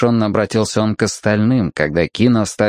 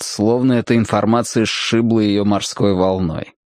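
usual speaking voice

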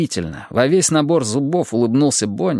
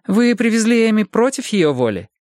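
much higher female interviewer voice; Bonnie; 9 semitones higher than usual. A narrator's reading. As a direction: probe in a high-pitched woman's voice. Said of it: "спросила Бонни". (высокий женский голос, с вопросом)

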